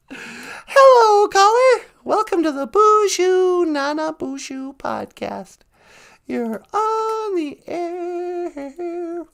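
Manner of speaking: radio host greeting. Pretend. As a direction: welcome the caller on air.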